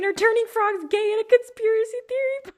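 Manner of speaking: high, loud incredulous read; can't believe this claim; rising into a laugh. Real, not acted.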